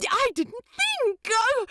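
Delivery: high, flustered angel voice. A narrator's reading. Aziraphale flustered after calling the demon rude, high-pitched. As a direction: stammer it, caught off guard.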